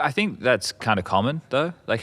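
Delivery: ordinary talk, nothing marked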